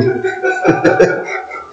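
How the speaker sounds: breathy, voiceless chuckling; real laughter trailing on; real amused laugh. Real, not acted. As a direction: laugh helplessly at the interruption. Amused by the talking phone.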